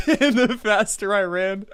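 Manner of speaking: laughing while talking; giggling through the words. Real, not acted. Excited laughing about VR idea.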